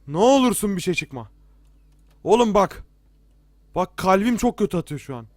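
loud, high begging; loud, frightened appeals; panicked pleading. Real, not acted. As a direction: plead urgently, heart pounding with fright. Scared, begs game not to scare.

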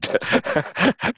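a quick high breathy laugh, rising at the end; giggling laugh. Real, not acted. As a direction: laugh at your own little trick. Laughs about placing the poster.

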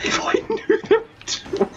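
high, squeaky voice breaking up with laughter; too overcome with laughter to finish; laughing too much. Real, not acted. Player trying to explain through giggles, laughing too much. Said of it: "I'm laughing too much".

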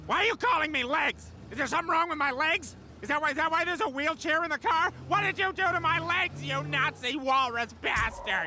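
high-pitched, paranoid tirade; frantic ranting. Acted. Drugged driver accuses passenger about his legs, frantic.

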